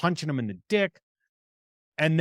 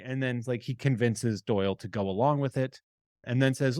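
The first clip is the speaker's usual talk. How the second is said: ordinary delivery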